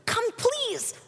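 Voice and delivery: restrained, urgent plea to a son; nervous pleading. Acted. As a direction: beg him over without raising your voice. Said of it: "the father grew more nervous".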